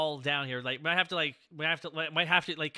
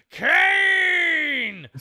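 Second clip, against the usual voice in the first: excited shout; 8 semitones higher, 8 dB louder than usual. Acted. Dramatic host intro of guests.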